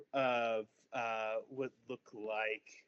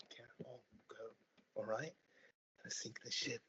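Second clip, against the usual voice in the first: urgent hushed warning; quiet stern whisper; 13 dB quieter than usual. Acted. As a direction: keep it from the children. Mother hushes other parents.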